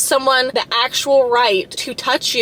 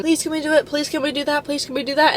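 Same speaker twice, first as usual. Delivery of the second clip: higher, softer repeated begging; mock pleading; 4 semitones higher, 3 dB quieter than usual. Someone pressuring another until they give in, mock pleading. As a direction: imitate someone wheedling for a yes. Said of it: "If you have to ask someone".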